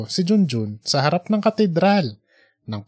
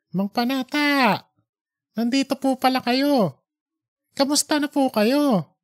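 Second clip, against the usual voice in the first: put-on young man's voice; 9 semitones higher than usual. Junjun greets the old man.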